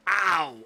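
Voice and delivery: mock pain yelp. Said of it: "split your finger open".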